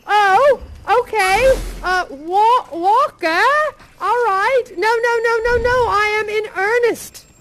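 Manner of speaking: put-on gleeful generous old man; eager Scrooge. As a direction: hurry the boy off. Scrooge urging the boy.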